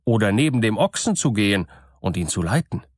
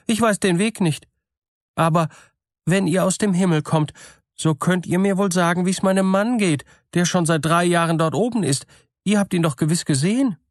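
lightly voiced eager simple woman, higher; eager to hear of her dead husband; gullible woman's voice; 8 semitones higher than usual. A narrator's reading. Gullible woman asks about husband. (gutgläubige Frau, eifrig und höher)

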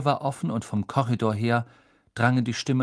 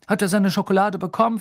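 high, louder, fast anxious question; worried Fudge; 9 semitones higher, 6 dB louder, faster than usual. A narrator's reading. Minister checks with nurse on patient, worried. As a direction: ask urgently, with concern. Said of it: "fragte er besorgt".

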